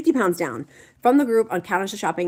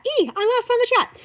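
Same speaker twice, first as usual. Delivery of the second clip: much higher, a playful squeal; squeaky mock panic; 5 dB louder than usual. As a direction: squeal it like a flustered cartoon. Squeaky repeat after losing place.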